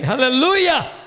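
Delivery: loud, high hallelujah shout. Real, not acted. Preacher to congregation, loud high exultant shout. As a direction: shout it out in triumph. Preacher's exclamation after the prayer.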